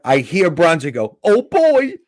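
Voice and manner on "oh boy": high, loud mock 'oh boy'; alarmed onlooker. Bronzy watching the comic take on a heckler, high-pitched dread. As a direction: imitate a bystander who sees trouble coming, pitched way up. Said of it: "just like that".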